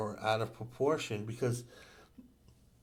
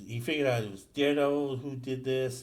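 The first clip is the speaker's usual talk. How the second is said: ordinary talk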